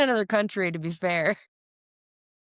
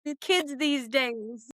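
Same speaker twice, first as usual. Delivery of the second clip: put-on old person voice; 4 semitones higher, much slower than usual. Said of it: "sound like an old person".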